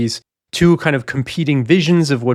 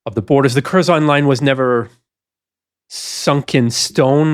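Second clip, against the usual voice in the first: ordinary talk, nothing marked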